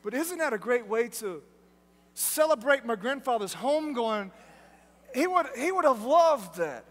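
usual speaking voice

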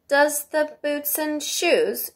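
slow, high-pitched puzzled echo; wondering. A narrator's reading. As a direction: repeat it in drawn-out wonder. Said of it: "she repeated in a wondering tone".

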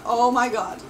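ordinary talk, nothing marked

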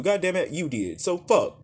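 normal talking voice

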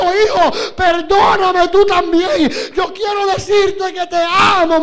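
loud, high, tearful plea of a weeping father; father sobbing. Retold or imitated. Preacher voices his father weeping in his arms, loud tearful plea. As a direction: cry it out as the father begging forgiveness. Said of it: "abrió la boca a gritar y a llorar".

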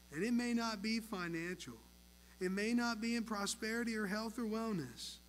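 earnest pastoral prayer building then settling; closing prayer. Real, not acted. Pastor prays for the congregation, earnest fervent prayer. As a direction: pray with rising fervour, then calm.

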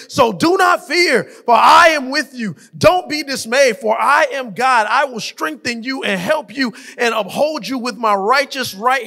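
loud, rising preacher's cadence, building with each verse; full-force revival preaching over the organ. Acted. Rapid-fire promise reading, escalating.

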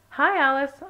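high, loud called greeting; calling out. A narrator's reading. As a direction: call hello to a friend. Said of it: "Anya called".

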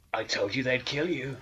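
normal talking voice

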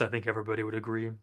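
ordinary talk, nothing marked

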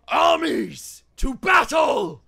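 huge, high, loud battle cry; commanding bellow. Acted. Knight calling his armies to battle, commanding bellow. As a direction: roar it out like a commander rallying troops. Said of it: "bellow out in a deep, commanding voice".

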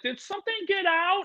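high squeaky worried imitation; a quiet high mock whimper; mock worried viewer. Viewers fearing a loose animal, high squeaky mock voice. Audience asking, mocked.

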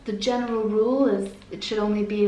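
ordinary talk, nothing marked

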